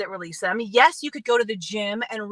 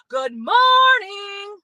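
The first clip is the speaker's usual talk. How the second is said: "Good morning" stretched very slow, higher and loud, almost a shout; long drawn-out greeting; 8 semitones higher, 7 dB louder, much slower than usual. Shown as a demonstration. Showing viewers a long greeting, drawn out and called loud. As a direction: drag every sound of "morning" out like a call. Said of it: "Let's make a long one".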